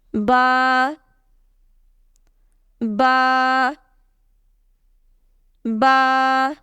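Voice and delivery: "ba" with the vowel held a little long; slightly stretched ba. Shown as a demonstration. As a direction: stretch each "ba" slightly, as in Quran recitation. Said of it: "read it with a slight stretch".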